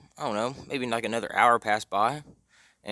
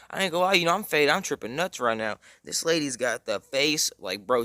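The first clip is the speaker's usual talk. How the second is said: normal talking voice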